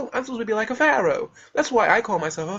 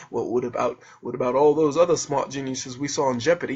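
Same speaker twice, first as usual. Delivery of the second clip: low-pitched mocking questioner; mock objector; 8 semitones lower than usual. Imagined sceptic questioning his claim to be smartest, low mock voice. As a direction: drop low to voice a doubter.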